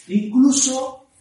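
normal talking voice

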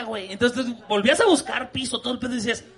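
usual speaking voice

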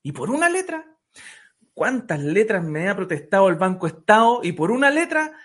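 high, loud incredulous tirade; indignant protest. Acted. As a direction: build outrage at such a trifle. Indignant parent escalates in joke.

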